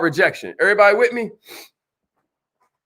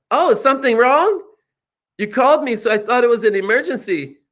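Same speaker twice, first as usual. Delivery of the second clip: put-on alarmed girl; 2 semitones higher than usual. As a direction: answer as if something must be wrong. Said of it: "and she's like".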